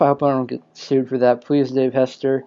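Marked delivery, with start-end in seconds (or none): none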